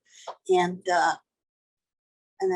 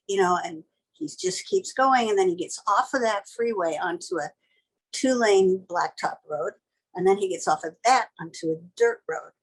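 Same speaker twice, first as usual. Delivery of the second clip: usual speaking voice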